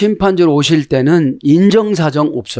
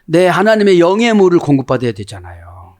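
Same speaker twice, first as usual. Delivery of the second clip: usual speaking voice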